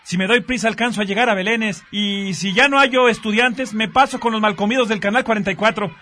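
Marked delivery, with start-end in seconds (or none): none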